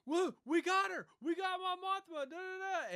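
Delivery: very high, loud mock yelling; retold yell. Driver yells that they got Mon Mothma, high mock shout. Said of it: "he yells, he goes".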